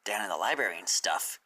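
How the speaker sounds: ordinary delivery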